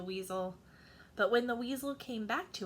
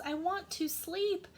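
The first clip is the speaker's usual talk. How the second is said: tired mouse shouts at the crickets to go; shouted plea; 5 semitones higher, 4 dB louder than usual. Acted. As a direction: beg them to leave, near shouting. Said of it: "shouted the mouse".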